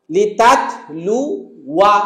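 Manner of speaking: লিতাতলুয়া syllable by syllable, very slowly; very slow recitation. Shown as a demonstration. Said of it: "okay".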